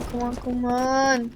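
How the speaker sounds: normal talking voice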